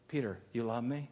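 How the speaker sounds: low, hushed, searching; gentle question. Acted. Jesus asking Peter about his love, gentle.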